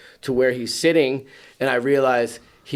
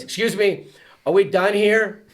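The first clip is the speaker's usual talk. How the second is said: put-on impatient kid interrupting; 7 semitones higher than usual. Imitating kid interrupting the adults.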